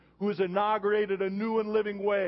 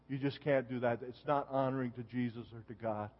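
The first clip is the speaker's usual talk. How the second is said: much softer and lower, gently pleading; quiet earnest counsel; 7 semitones lower, 7 dB quieter than usual. Retold or imitated. Pastor retelling counsel to the young man kneeling in his office, soft and earnest. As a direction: speak low and close, like praying beside him.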